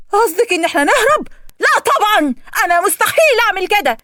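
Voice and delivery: high, loud outraged refusal; shocked refusal. A narrator's reading. Hagar refuses Yousra's plan to escape, outraged. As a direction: refuse outright to run away.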